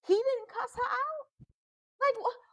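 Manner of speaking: high-pitched disbelief, falling away at the end; incredulous. Retold or imitated. Viewer reacting to the husband not cussing her out, incredulous. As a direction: blurt it out as a baffled viewer. Acted baffled reaction to the film.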